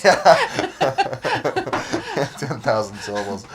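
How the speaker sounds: bursting into high laughter through the words; laughing hard while repeating it. Real, not acted. Laughing at the friend's impossibly long Greek name, cracking up.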